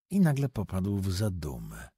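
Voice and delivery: ordinary delivery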